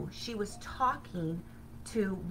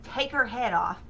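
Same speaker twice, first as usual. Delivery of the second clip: ordinary talk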